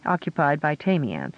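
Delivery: plain reading voice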